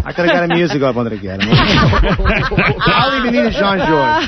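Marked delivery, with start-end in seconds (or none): 1.5-4.2 slow, higher, laughing through it; laughing offer. Real, not acted. Laughing while promising the feud's peace offering. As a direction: crack up mid-offer.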